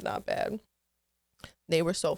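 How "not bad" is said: muttered. Retold or imitated. Muttering while watching a movie.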